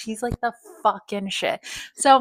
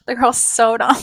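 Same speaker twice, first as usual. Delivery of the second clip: louder declaration; 2 semitones higher, 6 dB louder than usual. Real, not acted.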